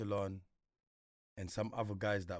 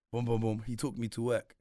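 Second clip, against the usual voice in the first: usual speaking voice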